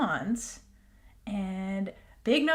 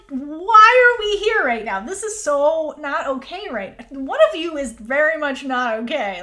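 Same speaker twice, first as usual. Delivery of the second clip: loud, high, emphatic objection; exasperated protest; 4 semitones higher, 7 dB louder than usual. Real, not acted. Podcaster objecting to the scene's timing, loudly exasperated. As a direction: protest loudly, leaning hard on the words.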